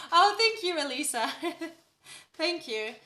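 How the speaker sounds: ordinary talk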